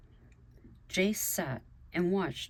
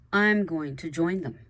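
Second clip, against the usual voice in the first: firm, louder announcement; emphatic and raised in volume; declaring; 8 dB louder than usual. A narrator's reading. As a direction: declare it out loud to the table. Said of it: "Dawn declared".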